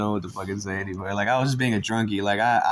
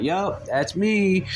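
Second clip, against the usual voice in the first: slow smug; 9 semitones higher, much slower than usual. Retold or imitated. Proud reply.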